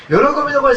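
喜びの声 punched out high and loud; emphatic preaching. Acted. Pastor urging the congregation to raise joyful voices, loud emphatic stress. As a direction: drive home 喜びの声 like a rallying cry.

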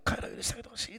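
breathy unvoiced whisper of a prayer; whispered plea. Jesus on the cross begging the Father to forgive them, whispered. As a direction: whisper it like a dying man's prayer.

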